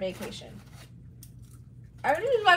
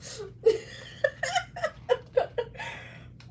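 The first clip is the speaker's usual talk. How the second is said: high breathy giggling; giggle fit. Real, not acted. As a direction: break into giggles. Laughing at own mess.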